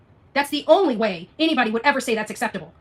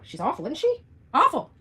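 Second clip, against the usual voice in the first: usual speaking voice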